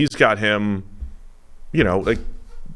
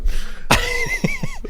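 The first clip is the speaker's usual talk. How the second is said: high laugh. Real, not acted. Laughs at the mock idea.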